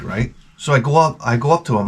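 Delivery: ordinary talk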